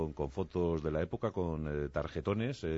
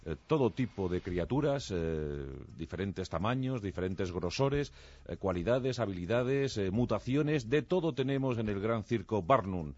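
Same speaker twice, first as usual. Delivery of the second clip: loud, higher circus ringmaster call; hyped fairground barker; 5 semitones higher, 5 dB louder than usual. Acted. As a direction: roll up, roll up showman pitch. Circus show opening announcement.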